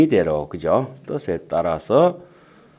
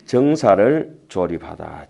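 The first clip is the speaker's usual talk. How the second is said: normal talking voice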